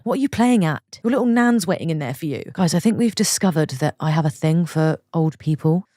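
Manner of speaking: ordinary talk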